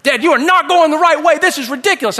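high, loud acted child's outburst; mock furious kid, yelling. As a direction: hammer out an exasperated protest at Dad. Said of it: "they're getting furious".

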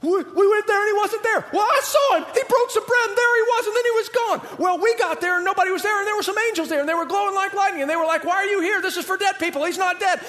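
high-pitched, frantic overlapping-style chatter; worked-up disciples all talking at once; freak-out. Acted. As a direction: babble it out in a high panicked rush of excitement. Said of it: "having a freak out meeting together".

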